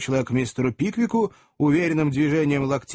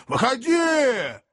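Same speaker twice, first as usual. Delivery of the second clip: high, drawn-out challenging shout; fighting challenge; 11 semitones higher, much slower than usual. Acted. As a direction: call him out, stretching the word. (протяжный боевой выкрик)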